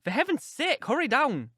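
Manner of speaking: high, alarmed urging; frantic warning; urgent exclaiming. A narrator's reading. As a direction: plead urgently for haste, pitch jumping up. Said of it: "I exclaimed".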